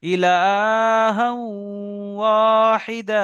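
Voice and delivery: ইলাহা ওয়াহিদা slow and loud, long nasal into the w; ghunna demo. Shown as a demonstration. Teacher reciting the next example, loud drawn-out recitation. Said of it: "একালিক পরিমাণ গণনা করে পড়তে হবে".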